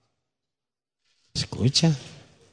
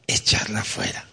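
whisper; 4 semitones lower, 7 dB quieter than usual. Acted. Whispered end of exhortation.

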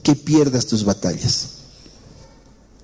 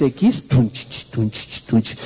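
cumbia beat imitation; 5 semitones lower, much slower than usual. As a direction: mouth the beat tunch tunch. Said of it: "empiezan a escuchar un tipo de cumbias". (imitando el ritmo de cumbia con la boca)

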